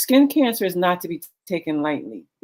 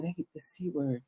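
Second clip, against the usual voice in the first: hushed, much softer, as if the word is forbidden; mock whisper; 12 dB quieter than usual. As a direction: drop to a secretive hush on the C word. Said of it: "like, whisper it".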